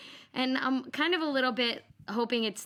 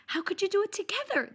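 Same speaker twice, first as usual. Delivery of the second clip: higher, incredulous mock disbelief; wife imitating husband amazed at bath time, mock shocked; 7 semitones higher than usual. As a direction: act out his amazement. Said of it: "he was so shocked".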